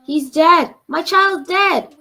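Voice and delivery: loud, high wailing shriek; mother shrieking over her limp child. Acted. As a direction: shriek in grief. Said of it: "The mother shrieked".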